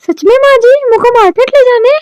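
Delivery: high, rising, eager question; can hardly believe her luck, voice leaping up; excited disbelief. Acted. Widow asks mother-in-law if it's true, high excited disbelief.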